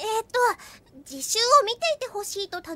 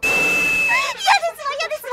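high, slow, louder squealing 嫌ですわ; flustered squeal; 10 semitones higher, 5 dB louder than usual. Acted. Kanna squealing to herself over her own daydream. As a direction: squeal with giddy embarrassment. Embarrassed fantasy about Chitose.

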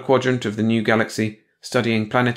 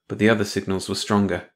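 plain reading voice

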